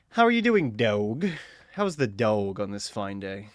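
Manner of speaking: usual speaking voice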